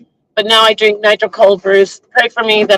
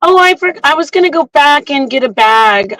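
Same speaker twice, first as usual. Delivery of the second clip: high-pitched imitation of a fibber; mock lying excuse; 5 semitones higher than usual. Girl caught leaving dog poop, mock innocent excuse. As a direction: mimic a flimsy excuse in a raised voice. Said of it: "she's such a liar, this girl".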